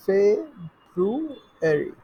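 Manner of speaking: "ফেব্রুয়ারি" slowly as "feb... ru... ary"; syllable demonstration. Shown as a demonstration. Teacher shows how we say February, "ফেব্রুয়ারি" slowly in parts. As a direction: stretch "ফেব্রুয়ারি" in three lilting parts. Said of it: "ফেব্রুয়ারিকে কিভাবে বলি".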